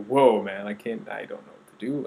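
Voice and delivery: a sudden loud "whoa", then back to normal talk; shocked friend. Retold or imitated. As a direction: imitate a stunned friend who is lost for words. Friends reacting to shocking news.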